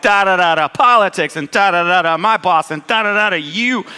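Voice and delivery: mocking blather. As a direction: mimic someone droning on and on.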